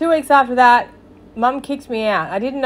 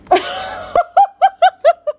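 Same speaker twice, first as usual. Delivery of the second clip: very high, helpless laughter; cracking up laughing; 4 dB louder than usual. Real, not acted. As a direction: burst into high-pitched giggles. Cracks up at pulling-out line.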